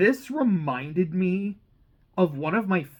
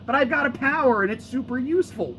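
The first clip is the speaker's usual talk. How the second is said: high, mock-bratty kid sister voice; mocking Imp; 10 semitones higher, 5 dB louder than usual. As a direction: parody an annoying little sister showing off. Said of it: "I found her kind of annoying".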